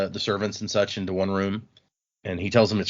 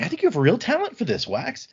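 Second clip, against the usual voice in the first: lightly voiced Steris; 10 semitones higher than usual.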